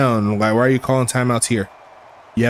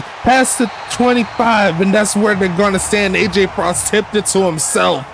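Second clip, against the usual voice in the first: excited play-by-play; 4 semitones higher than usual. Real, not acted. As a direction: call the return at full excitement. Interception return call.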